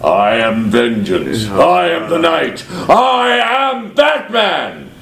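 slow, menacing Batman growl building to a shout. Pretend. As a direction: deliver it like the Dark Knight in the shadows. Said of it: "So back in the kitchen I do".

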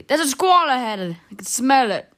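put-on loud, insistent old man; angry. A narrator's reading. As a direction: insist on it crossly and loudly. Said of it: "The old man said angrily".

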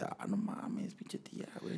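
whispered disbelief; shocked whisper. Retold or imitated. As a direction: mutter it under your breath, stunned. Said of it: "y acá ya así de". (susurro incrédulo)

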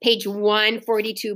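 usual speaking voice

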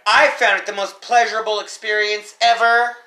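heavy sarcasm. Real, not acted. Topping friend's gripe about car costs, heavy sarcasm. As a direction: lay the sarcasm on thick.

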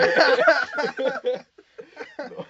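long loud high laughter. Real, not acted. Hearing the mango nickname for his friend, long loud high laughter. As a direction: crack up.

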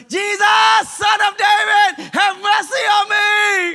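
a blind beggar yelling for help; desperate shout. Acted. Blind Bartimaeus shouting to Jesus from the roadside, desperate loud cry. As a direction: cry it out at the top of your lungs, slow and stretched. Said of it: "hat weiter geschrien".

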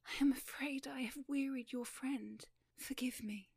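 breathy miserable whisper; sighing miserably. Acted. Young lady sighing to Beau Maturin, whispered. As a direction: sigh it out, hurt and apologetic. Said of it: "sigh miserably".

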